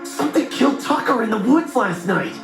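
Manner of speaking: alarmed, high-pitched news breaking; urgent shouting. A narrator's reading. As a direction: blurt it out to a just-woken roommate. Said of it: "Why are you shouting?".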